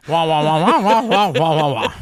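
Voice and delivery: muffled Charlie Brown teacher drone; wah wah adult talk. Retold or imitated. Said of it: "everything else sounds like the teacher in the Charlie Brown".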